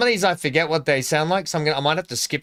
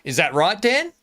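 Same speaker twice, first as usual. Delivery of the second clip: rising up at the end like a question, higher on Dan; mock Australian rising tone; 6 semitones higher than usual. Shown as a demonstration. Friend mocking the Australian accent with a rising end. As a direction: turn it into an Aussie question. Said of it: "the inflection of the word goes up at the end".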